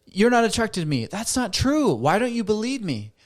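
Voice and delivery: rising into an upset argument; heated protest. Acted. Partner escalating the fight, high and heated.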